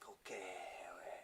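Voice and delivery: whispered priestly murmur; imitated hushed murmur. Priest with hand on patient's knee, imitated whisper. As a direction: murmur it low, almost without voice.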